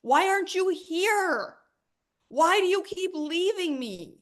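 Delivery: high, loud, slow furious rant at money; yelling angrily at money; mean it. Shown as a demonstration. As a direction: let the frustration out, really mean it. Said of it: "say it like you mean it".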